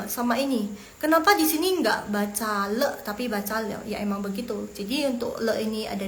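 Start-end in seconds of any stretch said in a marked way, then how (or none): none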